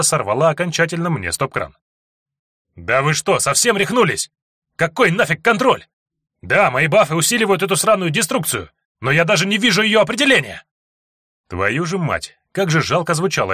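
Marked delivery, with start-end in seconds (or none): 2.8-10.6 возмущённый крик. Acted. Том срывается на товарищей.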